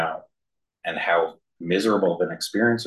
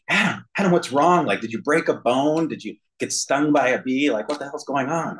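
higher, alarmed parental questions; worried parents; 7 semitones higher than usual. Retold or imitated. Parents bursting into the bedroom, higher alarmed questions. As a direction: rush in fearing he's hurt. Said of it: "They're like".

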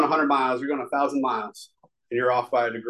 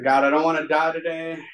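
a louder, raised crying plea to God; 4 semitones higher, 4 dB louder than usual. Retold or imitated. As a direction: beg God through tears. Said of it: "I ugly cried".

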